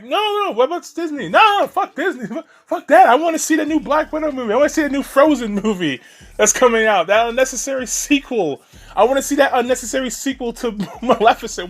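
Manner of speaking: high-pitched mocking fan whine of protest; mocking fanboy. Mocking fanboys who refuse to boycott Disney.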